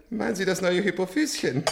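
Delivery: high cackling laugh through the words. Acted. Old patient mocks the nurse, laughing and high.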